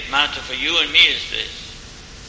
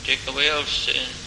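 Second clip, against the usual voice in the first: soft, steady, reverent pleading prayer; closing prayer; 4 dB quieter than usual. Acted. Preacher praying for the congregation's souls, quiet and reverent. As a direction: pray it quietly and humbly.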